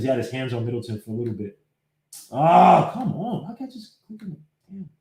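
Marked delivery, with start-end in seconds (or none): 2.3-4.9 real frustration, dropping to a mutter; exasperated. Real, not acted. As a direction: whine at the stubborn computer, then trail off under your breath. Computer will not click, he is exasperated.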